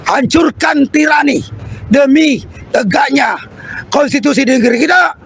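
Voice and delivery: rousing protest oratory; rally the crowd like a revolutionary; fiery revolutionary speech. Acted.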